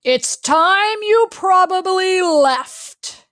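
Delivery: high, loud and slow, each word pressed; cold dismissal. Acted. Pete telling Pickles to leave, loud and slow. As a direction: send her away, hurt and hard. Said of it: "the last words ... were the worst".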